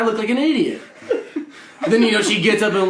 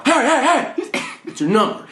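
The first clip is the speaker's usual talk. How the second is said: high-pitched heys, dropping on the question; mock aggressive come-on; 8 semitones higher than usual. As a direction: play a pushy guy accosting someone at a bar. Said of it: "saying all aggressive".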